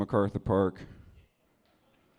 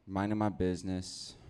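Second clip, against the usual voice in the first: normal talking voice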